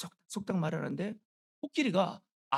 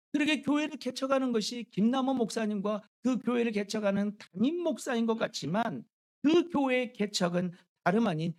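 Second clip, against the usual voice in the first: raised, louder pulpit voice; emphatic preaching; 5 semitones higher, 6 dB louder than usual. Real, not acted. Preacher tells the church the Lord is its owner, emphatic preaching. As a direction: drive the point home to the congregation. Preaches the main point forcefully.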